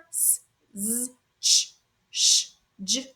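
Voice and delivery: "s, z, ch, sh, j" as bare hisses and buzzes; isolated consonant sounds. Shown as a demonstration. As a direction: sound out "s, z, ch, sh, j" one by one. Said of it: "words with the sounds".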